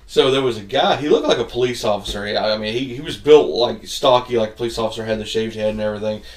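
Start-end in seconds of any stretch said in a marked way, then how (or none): none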